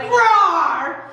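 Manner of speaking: high imitated roar; drawn-out storybook roar; mock roar. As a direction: roar like a strongman breaking loose. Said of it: "And Samson's like".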